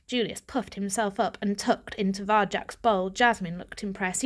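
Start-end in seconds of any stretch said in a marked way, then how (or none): none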